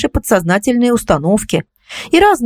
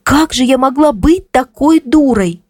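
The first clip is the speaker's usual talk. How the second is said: slow, higher-pitched lament; self-reproachful lament; 5 semitones higher than usual. A narrator's reading. Кристина blaming herself for being a fool, lamenting. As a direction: draw out the self-blame in a raised pitch. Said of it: "начала сокрушаться Кристина".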